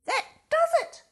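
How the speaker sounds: high, loud furious outburst; angry cry. A narrator's reading. Angry fox at the brick house, loud outburst. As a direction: snap in fury. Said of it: "cried the angry fox".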